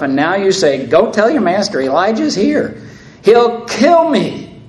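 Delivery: the second time, rising to real panic; desperate repeat. Acted. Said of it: "he repeats himself".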